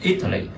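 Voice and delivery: Italy stressed on the first syllable, I-ta-ly; teacher modelling the stress; stress shown. Shown as a demonstration. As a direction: punch the opening \"I\" of Italy. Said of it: "là Y là trọng âm lớn nhất".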